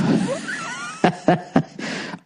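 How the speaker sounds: breathless wheezing laugh that hurts; painful high laugh. Real, not acted. Stuffed from a huge burger, wheezing painful laugh. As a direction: laugh through the pain of an overfull belly. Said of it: "it hurts to laugh".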